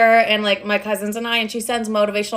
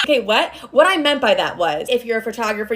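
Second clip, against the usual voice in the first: ordinary delivery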